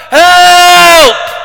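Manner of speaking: piercing high, long shout for help; huge scream. Acted. Man in the water crying for help, huge scream. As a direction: scream it out at full volume, held long. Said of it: "klonk er een hele grote schreeuw".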